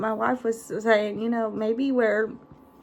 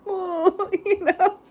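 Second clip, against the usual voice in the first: a hint of teary laughing; half-laughing, half-crying on the phone; mock boo-hooing; 8 semitones higher, much slower than usual. Calling her wife after the crash, teary half-laugh. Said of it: "boo-hooing".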